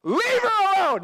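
loud, high-pitched barked order; over-the-top commanding shout. Acted. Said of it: "this is a command".

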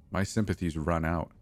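usual speaking voice

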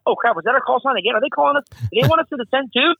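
high, hurried burst of questions; flustered pilots. Acted. Distracted pilots asking each other about a missed call, flustered. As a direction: blurt it out high, caught off guard. Acting out confused pilots mid-conversation.

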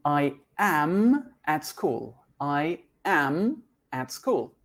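slow, clear "I am at school"; slow model answer. Shown as a demonstration. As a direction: read the answer slowly twice. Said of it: "That's right". (respuesta modelo, lenta)

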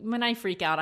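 normal talking voice

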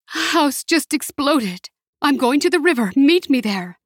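high, strained report; urgent distress. A narrator's reading. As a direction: force out urgent instructions while fleeing. Mother fleeing, gives urgent instructions.

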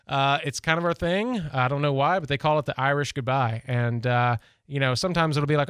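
ordinary talk

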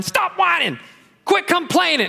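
put-on sharp high scolding shout at whining kids; parent scolding. Parent shouts at whining kids, high scolding yell. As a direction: snap at the kids to stop whining. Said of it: "They're whining".

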